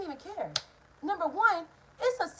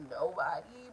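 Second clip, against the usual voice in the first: private muttered truth; 9 semitones lower, 9 dB quieter, slower than usual. Retold or imitated. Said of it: "I didn't wanna say that".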